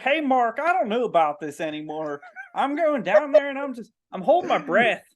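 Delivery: a worn-out counter pleading with his boss; struggling diver. Acted. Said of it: "He's like".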